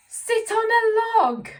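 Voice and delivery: slow, high, loud order; angry cat voice. Acted. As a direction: boss the frog about crossly. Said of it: "says it again in an angry voice".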